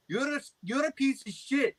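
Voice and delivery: high, put-on indignant voice; imitated angry scammer. Imitates angry scam caller.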